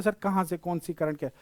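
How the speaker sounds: quiet mock student questioning; imitating confused students. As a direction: trail down quietly as a puzzled pupil. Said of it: "आप बोलते".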